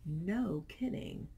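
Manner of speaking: ordinary delivery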